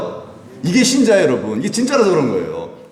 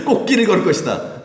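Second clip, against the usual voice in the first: high, amused mock proclamation; laughing mockery; 7 semitones higher than usual. Preacher mocks a false gospel promise, laughing. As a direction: quote the absurd promise through a laugh.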